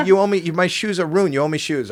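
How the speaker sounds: high, loud imitation of indignation; mock angry demand. Comic plays Lou demanding new shoes, mock angry and loud.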